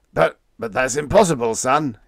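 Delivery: loud, shocked stammer; captain gasping at the pilot; gasping disbelief. A narrator's reading. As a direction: gasp it out. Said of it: "he gasped".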